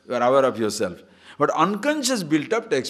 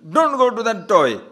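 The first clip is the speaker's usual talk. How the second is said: higher and louder, stern; scolding parent; 8 semitones higher, 7 dB louder than usual. Acted. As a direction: warn the child off. Scolding a child.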